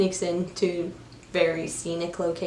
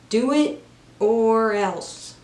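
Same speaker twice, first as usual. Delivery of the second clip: slow, louder threatening order; mock threat; 3 semitones higher, 5 dB louder, much slower than usual. Prison chef ordering his helper to work, mock-menacing. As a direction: lay it down slowly, imitating a menacing chef.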